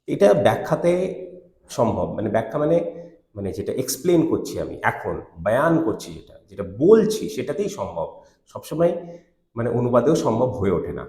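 usual speaking voice

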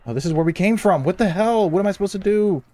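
high, loud frustrated rant; exasperated outburst. Real, not acted. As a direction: cry out at going in circles. Ends up where they started.

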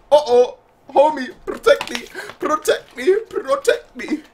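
very high imitated cry for help; mock pleading. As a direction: plead for protection in a high put-on voice. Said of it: "she's like".

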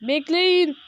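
calling after the group. Real, not acted. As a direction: call out to those ahead. Calling players to wait.

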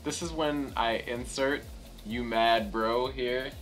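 normal talking voice

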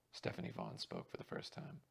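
plain reading voice

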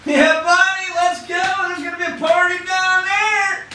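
high, loud party shout; whooping barfly cheering hell on; rowdy drunk shout. Retold or imitated. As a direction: yell it like a drunk ready to party. Drunk self answering a street preacher.

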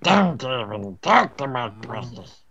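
slow, lightly voiced creepy creature; light Gollum imitation. Guarding a found item, a slow mock Gollum croon.

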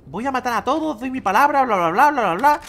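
hammed-up imitation of a bragging gamer; mock quote of Chico Games. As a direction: parrot the other player's big promise. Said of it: "Chico Games hace literalmente un minuto".

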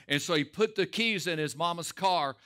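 ordinary talk, nothing marked

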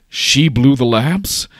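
slow, high, loud incredulous question; flabbergasted. Acted. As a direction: burst out in drawn-out disbelief, rising at the end. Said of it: "flabbergasted, like, unsure what's going on".

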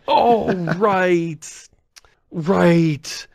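sudden realisation. Acted. Speaker mocks own slow memory hours after the show, sudden realisation. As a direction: act out the penny finally dropping. Acting out belated search realisation.

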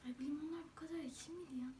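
fast whisper through sour lemon; eating sour lemon. Real, not acted. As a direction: whisper quickly, mouth puckered from lemon. Eating lemon during contest. (ekşi limonla hızlı fısıltı)

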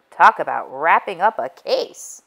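ordinary delivery, nothing marked

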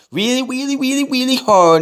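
put-on high whiny baby voice; whiny man child. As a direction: whine it like a big baby. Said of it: "he doesn't like a big baby voice".

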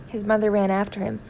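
ordinary delivery

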